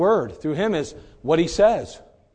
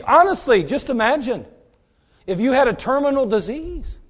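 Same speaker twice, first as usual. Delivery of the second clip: ordinary talk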